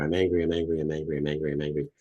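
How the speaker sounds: circle round the same words without a break; droning mental loop; looping thought. Acted. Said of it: "it just goes around there".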